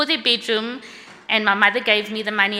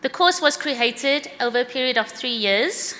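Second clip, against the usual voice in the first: ordinary talk, nothing marked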